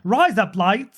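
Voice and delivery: "Rise up lights" slowed right down in a put-on Australian voice; Australian accent. Shown as a demonstration. Repeating the teacher's joke line in an Australian accent. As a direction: drawl it like an Aussie. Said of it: "That's razor blades in Australian accent".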